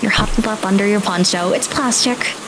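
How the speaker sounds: usual speaking voice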